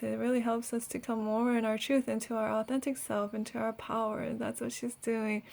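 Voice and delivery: ordinary talk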